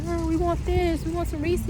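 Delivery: put-on high whiny kid voice asking for treats; lightly voiced begging children; mimicking kids. Mom imitates kids' texts.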